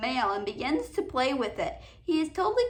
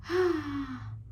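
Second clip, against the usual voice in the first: weary sigh; 4 dB quieter than usual. Real, not acted. Stuck finding episode info.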